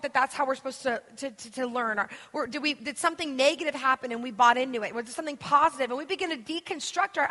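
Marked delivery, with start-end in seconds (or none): none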